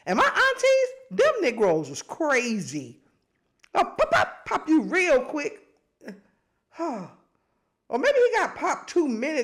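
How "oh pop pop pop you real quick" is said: a high, mock-stern auntie; a playful touch of a strict auntie threatening a pop; imitating auntie. Imitating the aunties who didn't play, mock stern. Voicing the aunties' swift discipline.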